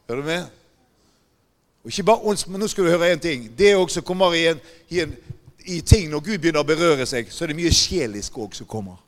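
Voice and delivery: loud, driving pulpit voice; preaching at full force. Real, not acted. Preacher warning the congregation about spirits, loud and driving. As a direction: hammer it home to the congregation.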